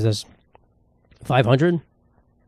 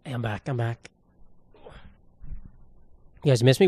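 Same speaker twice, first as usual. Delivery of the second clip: ordinary talk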